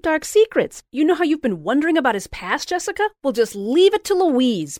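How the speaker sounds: high, loud, rapid gushing; gushing excitement. A narrator's reading. Louise gushing plans to Jessica, high and loud. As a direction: conspire with giddy glee.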